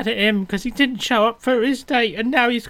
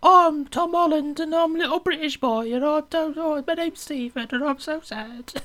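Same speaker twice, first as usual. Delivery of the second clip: higher, hammed-up little British boy; mock Tom Holland British; 9 semitones higher than usual. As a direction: play a whiny posh schoolboy. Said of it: "He's doing that".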